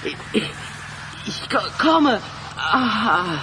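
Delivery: high, strained, breaking off mid-word; stammering, losing consciousness. Acted. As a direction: fight to get the words out while fading. Collapsing while answering friend. (stockend, der Ohnmacht nahe)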